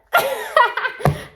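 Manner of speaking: high burst of laughter; amused laugh. Real, not acted. Joking that she's a city girl, high amused laugh. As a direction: crack up at your own joke.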